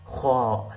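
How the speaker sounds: خا from the throat, not k; throaty kha. Shown as a demonstration. Teacher contrasting kha with ka. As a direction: rasp خا in the throat. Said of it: "not ka".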